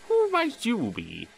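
slow, gentle elder engine; gentle kind question. Acted. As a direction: kind old engine greeting the newcomer. Kind old engine asks.

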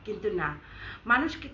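usual speaking voice

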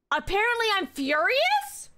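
high rising disbelief; baffled at the status; puzzled question. Real, not acted. Player puzzled by game status, rising question. Reads status, then repeats resigned.

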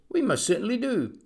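brisk, high little reply; piping voice. A narrator's reading. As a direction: pipe it quickly and high. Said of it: "piped the voice".

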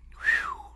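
soft whistle of amazement; impressed whistle. A narrator's reading. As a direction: whistle softly, impressed by the amount. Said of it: "gave a low whistle".

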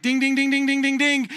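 high, rapid-fire dinging; excited high "ding" repeats; imitated bell. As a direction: ring like a game-show bell for a right answer. Imitates a right-answer bell.

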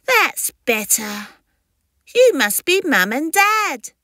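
high little creature voice, perky; cheeky hatchling. Acted. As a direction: greet the parents as a confident newborn. Newborn creature greets parents.

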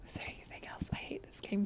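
very soft, low mutter to oneself; nervous whisper. Real, not acted. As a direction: whisper it under your breath. Nervous muttering while searching game.

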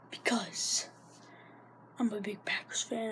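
soft unvoiced whisper. Real, not acted. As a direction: confide it quietly. Whispered aside.

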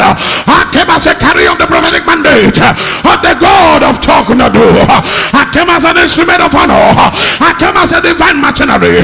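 building preacher's declaration. Acted. Preacher declaring deliverance to the church, building to shouts. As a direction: climb steadily until you're declaring it.

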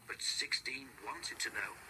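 ordinary delivery

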